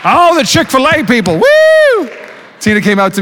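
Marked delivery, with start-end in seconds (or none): 0.0-1.3 excited shout. Real, not acted. Crowd cheering Chick-fil-A. 1.4-2.1 woo cheer. Real, not acted. Crowd cheering Chick-fil-A.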